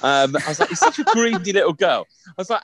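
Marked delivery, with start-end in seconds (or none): 0.7-2.0 laughing through the line; chuckling while scolding. Retold or imitated. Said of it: "I didn't call her a hoe bag".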